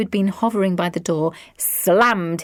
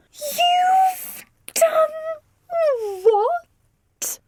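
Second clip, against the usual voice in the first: high, drawn-out wail; distraught shock; much slower than usual. A narrator's reading. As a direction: shriek it in horror. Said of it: "so distraught".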